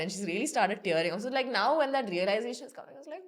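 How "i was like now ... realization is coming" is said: ordinary talk, nothing marked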